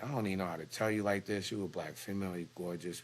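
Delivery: quietly confiding at the nurses' station; hushed confiding. Retold or imitated. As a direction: keep it down so nobody hears. Retelling a hushed nurse-station talk.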